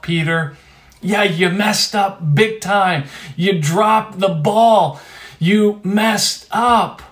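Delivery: emphatic acted scolding, stretching the key words; rousing rebuke. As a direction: drive each phrase home like a coach. Jesus rousing Peter, coach-like rebuke.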